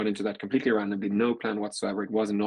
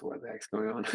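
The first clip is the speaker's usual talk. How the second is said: much higher, softer, stunned; baffled disbelief; 13 semitones higher, 7 dB quieter than usual. Retold or imitated. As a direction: sound thrown by the offer. Shocked at a buyout offer.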